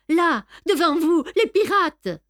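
high, loud warning; alarmed exclamation. A narrator's reading. Hero showing the captain the pirates before him, alarmed. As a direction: cry out the danger, high and insistent.

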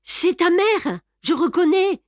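crié bien plus haut et plus fort; elle reconnaît la voix de sa mère, terrifiée; cri d'effroi. A narrator's reading. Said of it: "Raymonde gémit". (Raymonde entend le cri de la mère, affolée)

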